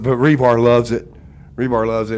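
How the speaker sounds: ordinary talk, nothing marked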